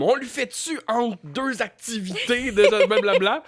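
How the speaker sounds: high mock Marie voice; imitating Marie. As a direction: voice her as a hurried friend. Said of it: "Marie est comme".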